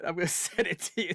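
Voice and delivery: usual speaking voice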